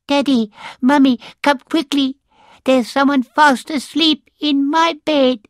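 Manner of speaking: a little bear calling his parents urgently; squeaky baby bear. Acted.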